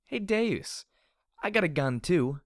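put-on high, loud drunken challenge; bold, taunting holler; shouted. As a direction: yell it clearly across at your enemy. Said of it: "I shouted".

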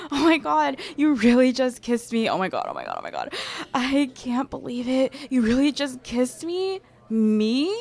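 loud, breathless disbelief; thrilled. Acted. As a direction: gush in disbelief. Stunned by the kiss.

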